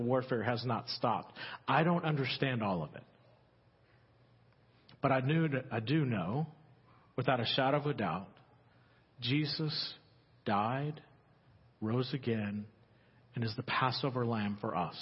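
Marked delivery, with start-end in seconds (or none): none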